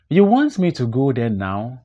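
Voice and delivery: ordinary talk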